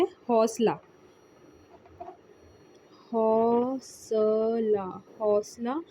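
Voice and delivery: हौसला said slowly, syllable by syllable; drilled pronunciation. Shown as a demonstration. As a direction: stretch हौसला out like a teacher. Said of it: "teacher drills the word". (हौसला को धीरे धीरे खींचकर बोलना)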